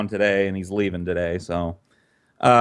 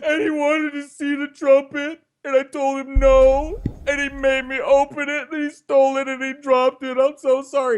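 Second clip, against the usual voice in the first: put-on sobbing kid; 3 dB louder than usual. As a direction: blubber it out like a scared little boy in tears. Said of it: "I just burst into tears".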